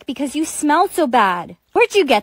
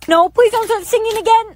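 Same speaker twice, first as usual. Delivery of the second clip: exasperated; 8 semitones higher, 5 dB louder than usual. Acted. Nurse exasperated.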